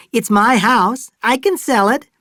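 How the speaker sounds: high, loud indignant insistence. Acted. Fay indignant at being refused.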